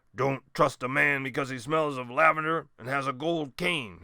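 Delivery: put-on loud gruff old captain's warning; a lightly voiced old seaman, growling advice; growled. A narrator's reading. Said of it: "he growled".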